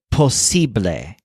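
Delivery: posible with the weight on si, po-SI-ble; Spanish posible, si stressed. Shown as a demonstration. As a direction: hit si in posible. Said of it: "with a stress on sib".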